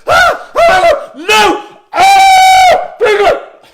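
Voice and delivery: very high, piercing screams; hysterical mother. Acted. Mother walks in on her son, screaming hysterically. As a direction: scream in full-blown horror.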